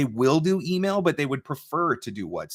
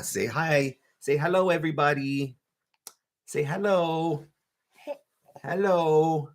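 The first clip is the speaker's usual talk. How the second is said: higher, stretched coaxing hello; 4 semitones higher, slower than usual. Real, not acted. Dad coaxing his baby to greet viewers, higher baby talk.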